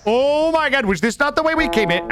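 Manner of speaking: panicked shriek. Real, not acted. Panicked gaming moment.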